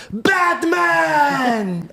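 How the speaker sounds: high, long-held holler; heckling shout. Acted. Imitating friend getting up to troll again, heckling shout. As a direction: stretch it out across the theater.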